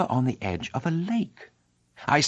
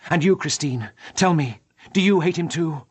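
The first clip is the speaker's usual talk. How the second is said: put-on higher, pleading young man; Raoul's voice; 5 semitones higher, 4 dB louder than usual. Raoul begging Christine for an answer, higher and urgent. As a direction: press her, voice lifted and urgent.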